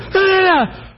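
high loud flustered yeah yeah; high abashed shout; sheepish realizing yell. Retold or imitated. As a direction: caught out and backing down. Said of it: "I literally went".

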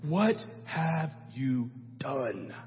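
low, soft, very slow and grave; heavy solemn prophet rebuke. Retold or imitated. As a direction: stretch it out like a stern judge. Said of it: "He's like".

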